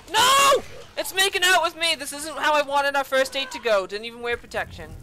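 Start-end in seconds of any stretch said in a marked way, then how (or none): 0.1-0.6 sharp high yelled protest; shouted no. Real, not acted. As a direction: yell it out in panic. Screaming at the game attack.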